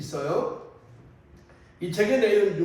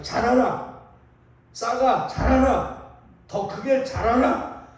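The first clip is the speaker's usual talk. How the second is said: storyteller's raised shout at a seedling; 4 semitones higher, 3 dB louder, slower than usual. Acted. Toad yelling at his seeds to grow, shouting. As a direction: call out high and loud, commanding it. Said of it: "외치기 시작했어요".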